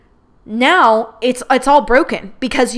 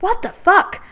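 hurt disbelief; 9 semitones higher, slower than usual. Retold or imitated. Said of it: "I just was hurt".

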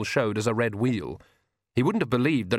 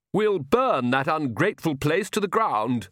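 raised, drawn-out moan of complaint; slow, higher-pitched aggrieved moan; 6 semitones higher than usual. A narrator's reading. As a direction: moan it slowly as a vampire countess. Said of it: "moaned the Countess".

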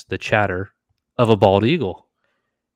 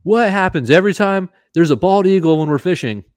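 high, loud call across the water; calling out to a far-off buddy; re-enacted yell; 10 semitones higher, 5 dB louder than usual. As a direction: holler it high, stretching the words. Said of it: "so I yelled so you could hear the echo".

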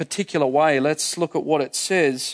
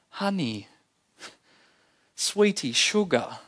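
tender pet names; 2 semitones higher, 4 dB quieter, slower than usual. Retold or imitated. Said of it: "the sort of phrase that a mum would say".

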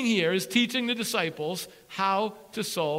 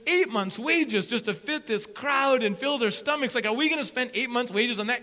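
a touch higher, fussy number-crunching; counting disciple; 5 semitones higher, 6 dB louder than usual. Pretend. Disciple adding up wages for the crowd, fussy. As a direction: lightly play the accountant tallying.

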